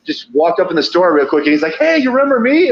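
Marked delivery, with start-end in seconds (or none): 1.8-2.7 put-on high, eager kid voice; a bright chirpy greeting from the kid; voicing the kid.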